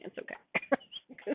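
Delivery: soft breathy whisper, drawn out slowly; hushed, slow little whisper; whispered reassurance. Real, not acted. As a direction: whisper it gently to comfort her.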